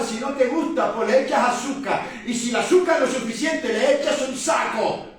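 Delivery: loud, rising fire-and-brimstone preaching; preaching at full force. Real, not acted. Pastor to the congregation about others' opinions, preaching at full force. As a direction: drive it home to the pews, full voice.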